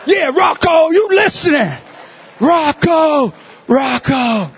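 loud calling out his name. Retold or imitated. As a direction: shout across to get his attention. Calling out to the child.

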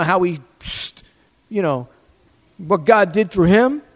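normal talking voice

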